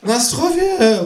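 very high, drawn kangaroo cheer; calling a toast. A narrator's reading. Kangaroo toasting with vodka, high cheering call. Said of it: "ruft das Känguru".

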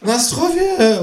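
very high, drawn-out kangaroo cry; high celebratory squawk; calling a toast. A narrator's reading. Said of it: "ruft das Känguru".

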